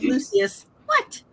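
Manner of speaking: put-on very high squeaky toddler What. Mother imitating her toddler answering her call, very high. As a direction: pipe it up like a small child. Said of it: "I was like".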